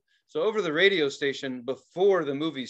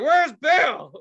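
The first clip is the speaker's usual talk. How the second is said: high, loud yell out of sleep; loud mock shout; 13 semitones higher, 8 dB louder than usual. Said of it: "Like, loud and".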